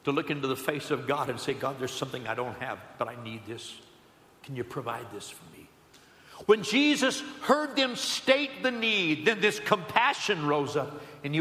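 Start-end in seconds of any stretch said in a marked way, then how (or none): 1.5-5.7 low, soft, quick plea; quiet acted request; humble prayer. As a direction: ask God humbly, voice dropping away. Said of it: "it takes some humility".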